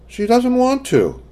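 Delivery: put-on high, hesitant little girl; young girl voice. A narrator's reading. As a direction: stammer it like a shy child. Child answering mother.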